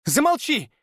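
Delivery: put-on very high shrill girl's cry; voiced girl squealing at him. A narrator's reading. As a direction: squeal the order. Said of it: "взвизгнула Виола".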